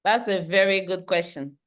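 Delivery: usual speaking voice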